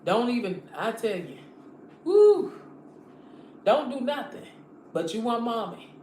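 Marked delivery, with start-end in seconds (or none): 2.0-2.5 loud high whoop; woo exclamation. Real, not acted. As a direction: let out a sudden loud "woo". Sudden exclamation mid rant.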